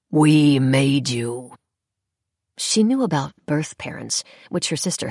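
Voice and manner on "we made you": slow, louder rasp; rasping claim. Acted. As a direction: rasp it out with cold pride. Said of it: "rasped the other".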